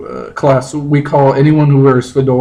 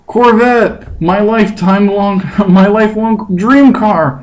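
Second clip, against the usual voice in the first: Justin role; 6 semitones higher, slower than usual. Acted. Reading Justin's part in play.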